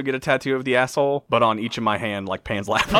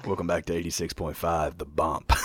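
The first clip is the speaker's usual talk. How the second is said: radio DJ; 7 semitones lower, 7 dB quieter than usual. Pretend. Mock radio DJ intro.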